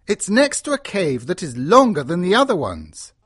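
high-pitched, wondering character voice; excited deduction. A narrator's reading. Lord reading the map of the caves, excited. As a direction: puzzle it out eagerly, piece by piece.

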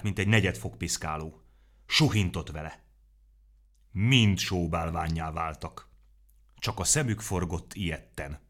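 plain reading voice

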